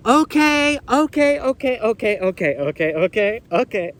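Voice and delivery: usual speaking voice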